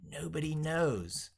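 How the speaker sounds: ordinary delivery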